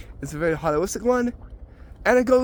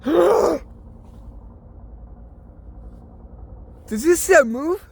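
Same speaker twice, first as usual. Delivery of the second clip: startled gasp; 10 semitones higher, slower than usual. Acted. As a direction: jump at the moving face. Sees a face in a tree.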